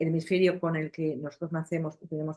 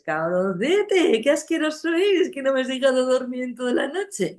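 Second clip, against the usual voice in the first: higher, louder, very slow at the start, crooning; sweet-talking insults; 8 semitones higher, 8 dB louder than usual. Shown as a demonstration. Parent cooing mock insults at a baby. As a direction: coo rude words in a loving tone. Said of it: "si a un niño tú le dices".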